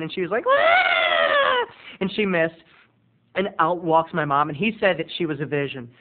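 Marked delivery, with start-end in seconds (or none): 0.5-1.7 high, loud mock shriek; grandma's dazed shriek. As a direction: shriek like a batty, drugged old lady. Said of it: "he tried to shake her hand".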